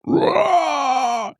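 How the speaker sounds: long, high shouted monster roar; hydra roar. Acted. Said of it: "You could hear the, the Hydra roar".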